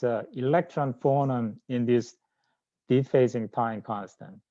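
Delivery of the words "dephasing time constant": ordinary talk, nothing marked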